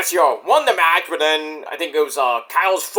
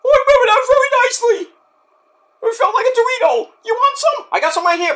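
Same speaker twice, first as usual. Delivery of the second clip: high, fast, squeaky cartoon voice; squeaky voice offering Doritos; squeaky silly voice; 3 dB louder, faster than usual. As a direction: play a goofy squeaky character. Silly voice of Mick Foley.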